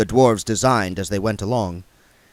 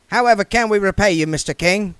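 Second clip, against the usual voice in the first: much higher, hurried thanks from the dwarf; the dwarf hurrying William away from the king; Goodmountain's voice; 10 semitones higher, 5 dB louder than usual. Acted. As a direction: gush thanks while backing out the door.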